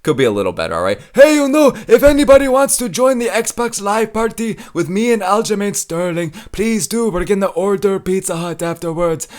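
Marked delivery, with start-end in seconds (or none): 1.1-9.3 Merab impression. YouTuber doing Merab again, high eager invite. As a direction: shout the invite like an excited fighter.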